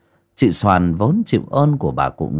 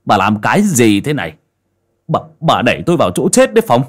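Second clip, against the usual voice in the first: louder, fast furious demand; furious son; 5 dB louder, faster than usual. Acted. Son yells at his mother for betraying him, louder and fast. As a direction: let it burst out louder and faster. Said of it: "máu nóng sộc lên não".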